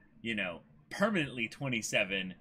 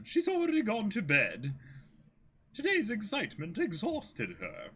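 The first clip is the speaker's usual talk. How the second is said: higher, gentle butler voice; polite butler; 7 semitones higher than usual. Acted. Merry to Kuro, a gentle higher polite reply. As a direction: explain kindly like a servant.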